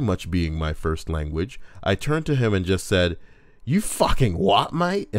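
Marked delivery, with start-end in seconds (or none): 3.6-5.1 put-on retorting in a South London accent; a brash South London "you what, mate". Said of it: "in my clearly from South London accent".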